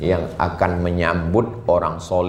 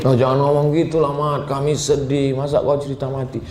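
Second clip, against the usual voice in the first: higher-pitched upset friends, acted out; pleading protest; 8 semitones higher than usual. Friends begging him not to talk of dying, pleading protest.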